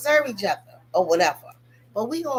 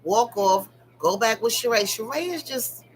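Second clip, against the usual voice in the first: ordinary talk, nothing marked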